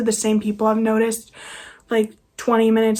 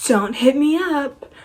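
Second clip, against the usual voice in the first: slow, higher-pitched mimicry; mocking quote; 4 semitones higher, slower than usual. Quoting needless social media posts, slow and mocking. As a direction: drag it out high to mock the posters. Mimicking posters she finds silly.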